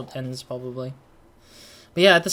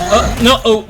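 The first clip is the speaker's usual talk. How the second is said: much higher, loud alarmed oh no; alarmed cry; 7 semitones higher, 12 dB louder than usual. Real, not acted. Gamer about to take a hit, loud alarmed cry. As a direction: panic at the danger on screen.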